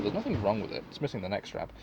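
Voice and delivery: ordinary talk, nothing marked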